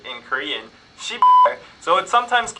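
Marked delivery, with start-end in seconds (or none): 1.0-1.6 curse word. Shown as a demonstration. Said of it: "the most used curse word".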